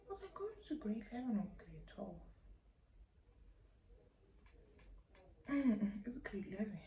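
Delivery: quick hushed whisper to someone nearby; whispered aside. Real, not acted. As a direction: drop to a whisper, asking off to the side.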